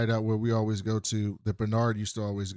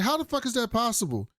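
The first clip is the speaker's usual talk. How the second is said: much higher, mock disbelief; stunned question; 11 semitones higher than usual. Cop staring at the footage, hammed-up high disbelief. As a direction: ask it in pitched-up disbelief.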